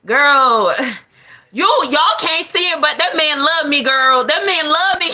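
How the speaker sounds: high, insistent lovestruck voice; Keisha insisting. Acted. Keisha defending her man to friends, high and insistent. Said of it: "I'm doing the whole scenario".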